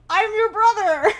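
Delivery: "I'm your brother" yelled high through laughter; laughing reveal. Retold or imitated. Retelling the surprise brother's arrival, laughing hard. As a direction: cackle the reveal out loud.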